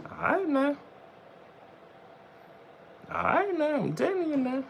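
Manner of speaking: mock warning. Streamer warning viewers to get out, mock falsetto. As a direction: squeak a playful warning in falsetto.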